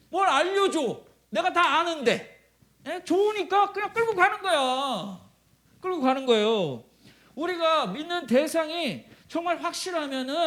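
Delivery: high, loud, insistent delivery; full-force preaching. Real, not acted. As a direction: press the point home from the pulpit.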